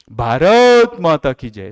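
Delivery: drawn-out slogan shout; loud slogan. Shown as a demonstration. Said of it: "ये जोर से चिल्लाना होता है".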